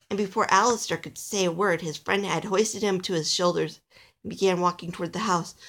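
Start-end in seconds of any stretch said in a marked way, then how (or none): none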